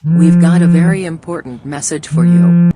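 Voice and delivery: loud, high taunting voice; mocking wake-up. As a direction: tease him awake.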